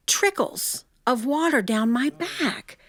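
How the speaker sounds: plain reading voice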